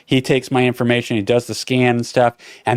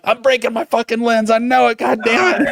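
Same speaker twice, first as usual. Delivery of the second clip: panicked high voice; 10 semitones higher than usual. Retold or imitated. Panicked running muttering.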